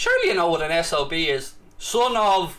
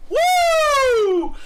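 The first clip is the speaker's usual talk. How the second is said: triumphant whoop; 6 dB louder than usual. Acted. Taunting commenter Jake.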